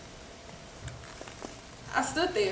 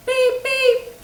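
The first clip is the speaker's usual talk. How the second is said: high, loud beep beep; imitated car horn; 11 semitones higher, 7 dB louder than usual. District manager honking at the drive-thru, loud high beeps. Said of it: "he immediately blew his horn".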